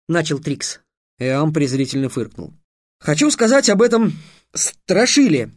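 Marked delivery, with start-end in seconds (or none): 3.0-5.5 higher, strained squeeze of words; a boy forcing himself to speak; forced out. A narrator's reading. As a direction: push the words out reluctantly, higher. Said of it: "выдавил из себя Трикс".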